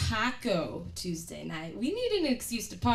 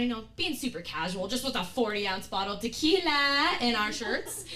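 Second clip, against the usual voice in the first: ordinary talk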